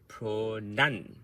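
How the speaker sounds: pronunciation broken up, NUN stressed and held. Shown as a demonstration. Coach showing where word stress falls, pronunciation with NUN stressed. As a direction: say pronunciation in parts, hitting nun. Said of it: "So the nun is the word stress".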